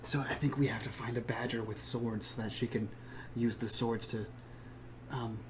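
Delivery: soft hushed whisper; leaning in to whisper. Real, not acted. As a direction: hush it so guards won't hear. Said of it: "I was using my whisper voice".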